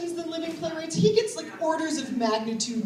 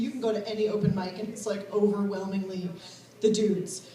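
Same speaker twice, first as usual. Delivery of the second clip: usual speaking voice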